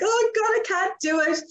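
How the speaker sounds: high mock panic, imitating her past self; panicked. Retelling her Facebook Live fear, high mock panic. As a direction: squeal it like you're dreading going live. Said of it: "and I'm like".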